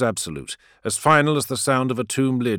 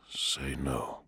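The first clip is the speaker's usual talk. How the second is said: barely breathed whisper; 7 semitones lower, 11 dB quieter than usual. A narrator's reading. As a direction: breathe it so no one hears. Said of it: "whispered Nairo, barely breathing the words".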